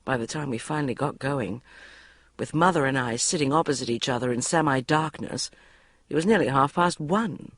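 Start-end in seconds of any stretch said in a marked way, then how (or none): none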